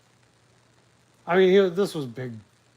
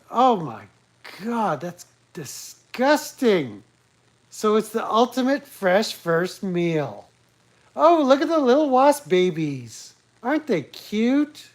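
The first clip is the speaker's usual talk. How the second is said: fake squeamish; 5 semitones higher, 3 dB louder than usual. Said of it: "just my superior acting skills".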